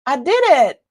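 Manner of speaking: loud, high cheer; excited little victory shout; triumphant. Real, not acted. Triumph after fiddling with chat.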